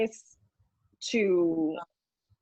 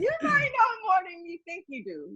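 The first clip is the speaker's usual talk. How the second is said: laughing; 10 semitones higher, 3 dB quieter than usual. Real, not acted. Giggling through playful claim.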